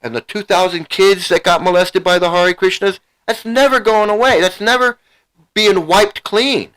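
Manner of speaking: real upset outburst. Real, not acted. A father raging at the cult that abused his daughter, upset and rising. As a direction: voice climbing high, pressed with grief and anger. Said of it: "here I am getting excited and getting upset".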